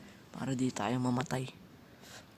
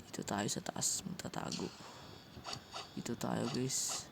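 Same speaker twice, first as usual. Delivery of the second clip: whispering; 5 semitones lower, 10 dB quieter than usual. Real, not acted. Hiding from players in game.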